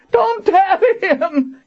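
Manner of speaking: slow, very high agonized scream. A narrator's reading. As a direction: scream the warning, stretching each word. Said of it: "Kara screamed".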